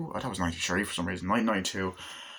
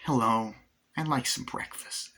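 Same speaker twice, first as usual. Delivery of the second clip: Jack Nicholson impression; 3 semitones higher than usual. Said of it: "that was more like Jack Nicholson".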